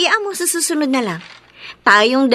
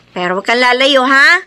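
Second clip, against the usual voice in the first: ordinary talk, nothing marked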